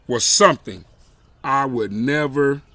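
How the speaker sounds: ordinary delivery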